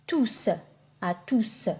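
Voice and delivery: "tous" as tooss, ending in a clear s; final S sounded. Shown as a demonstration. Teacher showing the pronounced S, "tous" said tooss. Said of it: "on le prononce".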